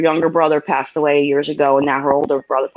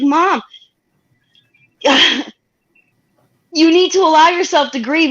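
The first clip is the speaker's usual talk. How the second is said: high, louder voice near tears; tearful plea to mom; 10 semitones higher, 4 dB louder than usual. Retold or imitated. Daughter telling grieving mom, tearful high plea. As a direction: beg her gently to let herself grieve.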